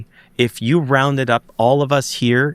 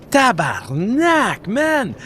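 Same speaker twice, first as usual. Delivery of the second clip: high, slow freaked-out Québécois curse; the cop losing it, stretched and squeaky; freaked-out swearing; 11 semitones higher, much slower than usual. Retold or imitated. Cop seeing the craft fly over, high, slow freaked-out swearing. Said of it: "he freaked out".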